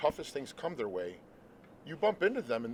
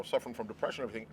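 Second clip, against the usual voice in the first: usual speaking voice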